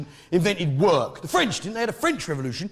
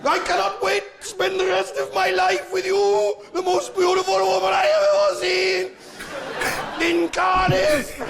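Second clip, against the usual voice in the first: put-on tormented Welsh accent; 13 semitones higher than usual. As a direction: wail wedding vows in an agonised Welsh accent. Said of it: "he sounds as though he's dying of some inner fucking hurt and torment".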